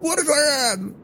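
high muffled voice from behind a door. Acted. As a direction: shout through a closed door. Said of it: "muffled voice from behind".

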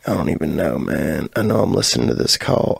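normal talking voice

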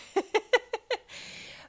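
high, airy giggle. Real, not acted. Laughing about the big anesthesiologist's accent, high giggle. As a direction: let out a real high laugh.

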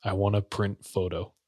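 low and quiet, playing a sullen kid; mock a subdued, minimal answer; sullen kid imitation. Calmed kid tells his minder what he wants, subdued.

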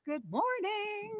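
soft, very high drawn-out hello; slow, high-pitched singsong welcome; high singsong greeting. Real, not acted. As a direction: trill the greeting up high, softly. Singsong greeting to online viewers.

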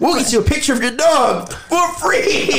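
infomercial. Pretend. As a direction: hype it like a TV salesman, laughing. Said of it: "I gotta do commercial".

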